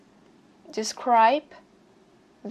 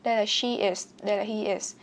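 ordinary talk, nothing marked

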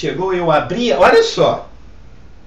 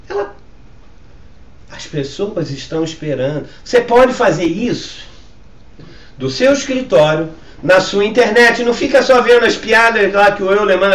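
normal talking voice

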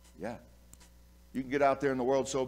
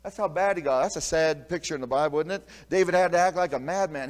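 ordinary delivery

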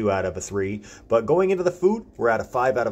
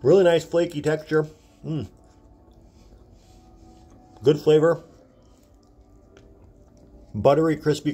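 talking while chewing; 5 semitones higher, 4 dB louder than usual. Real, not acted. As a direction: mouth partly full. Seen 1: Bit croissant at -4s; jaw working, lips pressed while talking.

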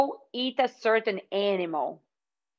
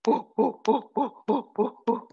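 short, rhythmic gorilla hoots; gorilla sound. Pretend. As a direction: hoot in bursts like a gorilla. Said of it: "Make a gorilla sound".

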